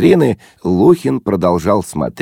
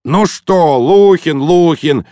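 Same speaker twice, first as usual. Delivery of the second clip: high, louder mimicking repetition; irritated retort; 8 semitones higher, 4 dB louder, slower than usual. A narrator's reading. Guest snapping back at his pleading friend, irritated. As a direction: throw the name back testily. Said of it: "огрызнулся Лухин".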